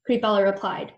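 ordinary delivery, nothing marked

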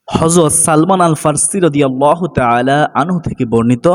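ordinary talk

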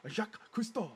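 French accent, played for laughs; funny French accent. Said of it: "do the funny voice".

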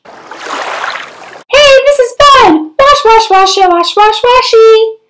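high, loud and gleeful; delighted pigeon. Acted. Pigeon happily playing in the bath, high and gleeful. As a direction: bubble over with joy in the tub.

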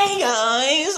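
high, nasally mocking copy. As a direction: echo it high through the nose. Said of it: "You sound like you're nasally".

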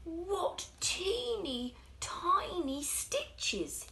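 high, slow, amazed little voice; shoemaker's wonder. Acted. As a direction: marvel at the tiny stitches, drawn out. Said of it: "he said".

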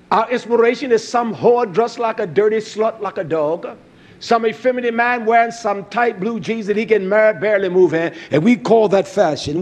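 loud, driving sermon delivery; fiery pulpit preaching; full-force preaching. Acted. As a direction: preach it out to the congregation. Preaching against fashion.

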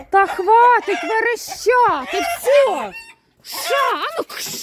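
high, slow shout to stop the squealing; exasperated shout. Real, not acted. Fed-up farmer shouting her squealing hens into silence.

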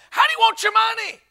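put-on high, piping girl's voice; squeaky retold cashier question; little girl clerk. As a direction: imitate a little girl at the counter. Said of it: "she's a little girl".

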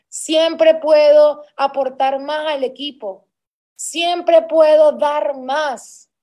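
slow, loud, each puedo hammered; loud mantra. Retold or imitated. Saleswoman reciting her own drive to the team, loud and slow. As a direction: declare it like a battle cry, slowly.

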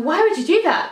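puzzled disbelief at the choice; incredulous thought. Retold or imitated. Friend recalling her reaction to the reading order, baffled. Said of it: "And I was thinking".

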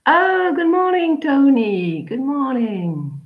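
high, bright hello to a latecomer; cheerful greeting. Real, not acted. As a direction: greet him like you're delighted he showed up. Greeting a late student.

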